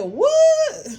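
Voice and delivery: a jolt of disbelief; shocked what. Retold or imitated. To her auntie on hearing of betrayal, high shocked "What?".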